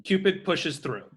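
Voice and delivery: normal talking voice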